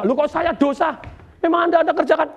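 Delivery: mock protesting teacher.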